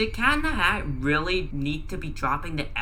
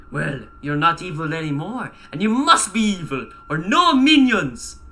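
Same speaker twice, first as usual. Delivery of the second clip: Drew's voice; 5 dB louder than usual. A narrator's reading. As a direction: build to an emphatic accented warning. Drew lectures Gru in put-on voice.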